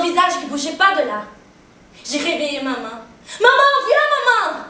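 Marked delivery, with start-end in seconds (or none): 3.4-4.6 high, loud cry for help; frightened calling. Acted. Daughter calling mother to the face on the wall, high and alarmed. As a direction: call mother urgently across the house.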